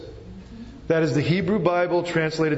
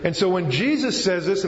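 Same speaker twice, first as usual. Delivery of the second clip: ordinary talk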